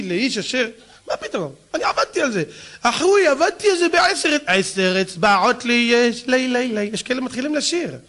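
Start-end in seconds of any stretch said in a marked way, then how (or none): none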